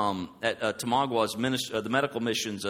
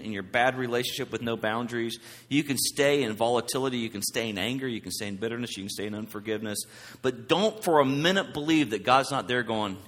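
ordinary talk

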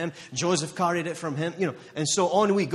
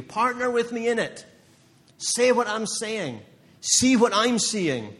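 building to a loud, high preaching peak; rising fervor, louder and higher; fired up; 5 semitones higher, 4 dB louder than usual. Real, not acted. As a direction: preach it harder and higher, slowing on the last lines. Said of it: "So I'm getting a little fired up now".

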